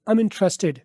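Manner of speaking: ordinary talk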